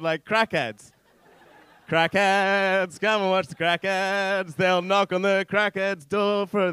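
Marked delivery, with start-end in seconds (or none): 1.9-6.7 louder, sing-song TV promo voice; show theme announcer. Acted. Comic promoting an imagined crackheads show, chanty announcer. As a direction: plug the show like a jingle. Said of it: "There should be a show called".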